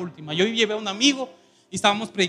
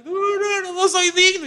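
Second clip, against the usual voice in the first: crying refusal; 11 semitones higher, 3 dB louder, slower than usual. Retold or imitated. Crying friend refusing the offering.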